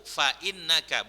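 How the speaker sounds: "fa innaka" with a glottal break after fa; careful "fa innaka", hamza sounded; hamza pronounced. Shown as a demonstration. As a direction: keep fa apart from innaka. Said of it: "bukan fa innaka".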